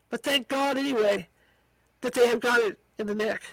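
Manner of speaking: plain reading voice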